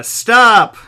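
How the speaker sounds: loud, high booming STOP; booming whiny voice. Acted. Voice from above halting Balak, booming shout. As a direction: boom it from above. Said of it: "a booming, whiny voice said".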